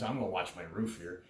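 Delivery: ordinary talk, nothing marked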